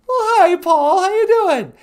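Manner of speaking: very high squeaky puppet wife greeting. Acted. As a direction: chirp it in a tiny high voice.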